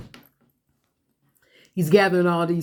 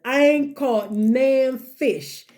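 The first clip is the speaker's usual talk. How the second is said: slow, high, indignant; slow mock grievance; slow drawn-out complaint; 8 semitones higher, much slower than usual. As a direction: drag the words out in protest. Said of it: "Peter says".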